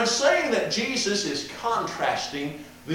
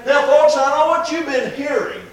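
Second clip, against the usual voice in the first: higher, chatty mock-teacher voice, slowing at the end; folksy explainer; 5 semitones higher, 5 dB louder than usual. Preacher mimicking a hypothetical folksy Jesus correcting hearers, higher. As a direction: play a folksy preacher setting folks straight. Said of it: "neither do you see him say".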